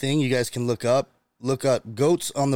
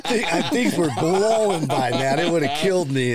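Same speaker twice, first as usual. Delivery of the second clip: laughing; 6 semitones higher than usual. Real, not acted. Laughing while telling the storm.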